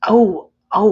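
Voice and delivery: loud, high "oh" of recognition; sudden realization. Real, not acted. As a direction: burst out as it suddenly clicks. Sudden realization while reading chat.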